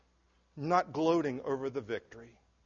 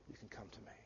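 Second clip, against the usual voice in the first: breathy whisper, much softer and lower; whispered invitation; 8 semitones lower, 28 dB quieter, faster than usual. Acted. Preacher whispering Jesus' invitation to the congregation, hushed. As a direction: breathe it like a secret.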